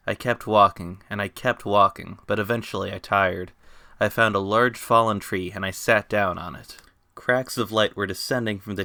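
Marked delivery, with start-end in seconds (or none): none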